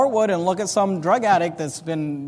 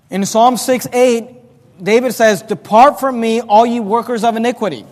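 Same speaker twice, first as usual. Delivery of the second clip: loud, high fervent sermon; full-force preaching; 6 semitones higher, 8 dB louder than usual. Acted. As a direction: preach it out to the congregation.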